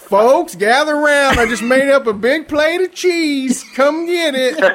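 high, mock Southern hostess calling folks in; hammed-up country host inviting everyone to cheese.

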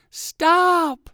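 high, loud drawn-out yell; desperate cry. A narrator's reading. Dizzy fox begs the spinning dog, desperate cry.

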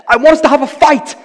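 high, loud "I want us to have a fight"; mock demand. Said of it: "she's going".

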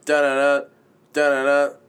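usual speaking voice

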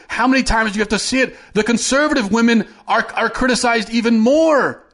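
high-pitched, exasperated scolding; exasperated rant. Real, not acted. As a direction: berate them, pitch well up. Berates listeners for not seeing it.

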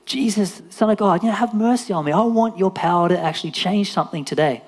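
high, insistent cry; imitated yelling. Blind Bart begging Jesus for power, imitated yell. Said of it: "He kept yelling all the more".

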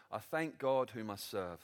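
slow, soft reading of scripture. Acted. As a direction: read the verse slowly and gently. Reads scripture verse.

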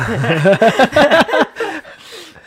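a loud burst of real laughter. Real, not acted. Guest laughing at a loaded question, loud real laugh. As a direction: break into a big rueful laugh.

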